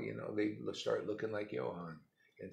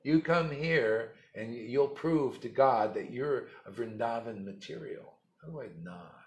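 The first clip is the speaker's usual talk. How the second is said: normal talking voice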